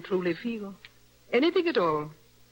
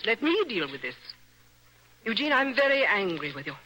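normal talking voice

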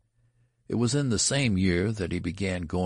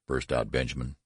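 ordinary delivery, nothing marked

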